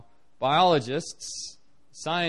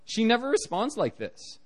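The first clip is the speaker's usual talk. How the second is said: relatives amazed; 7 semitones higher than usual. Retold or imitated. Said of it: "they're like".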